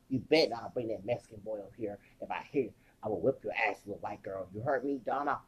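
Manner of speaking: hint of an angry father; stern father. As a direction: threaten her low and quick. Father threatens daughter Donna.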